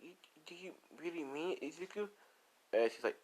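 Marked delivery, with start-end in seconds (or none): 0.0-2.1 small, high, soft acted voice; hurt. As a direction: ask it softly and high, acting wounded. Tearful ex hears she is rejected.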